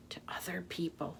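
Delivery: whisper. Acted. Advice to children.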